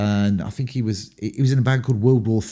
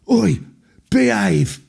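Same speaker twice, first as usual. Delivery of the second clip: raised high call across the room; 9 semitones higher, 3 dB louder, slower than usual. Retold or imitated. [name] stopping two men brawling on the floor, raised call. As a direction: bark it from the back to break up the fight. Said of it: "suddenly I hear from the back of the room".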